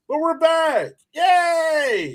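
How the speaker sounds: loud, high cheer; excited. Real, not acted. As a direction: cheer it like a welcome back. Cheering the show's return.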